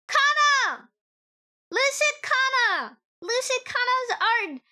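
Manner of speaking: high, loud gushing over a favorite artist; excited squeal. Real, not acted. Fan gushing over Lucid Kana's art, excited squeal. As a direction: squeal the name like a thrilled fan.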